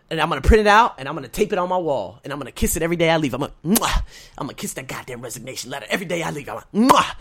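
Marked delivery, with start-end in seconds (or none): 3.6-4.0 smacking air kiss; loud playful smooch noise; kiss sound. Pretend. Imitating kissing the letter. 6.9-7.1 smacking air kiss; loud playful smooch noise; kiss sound. Pretend. Imitating kissing the letter.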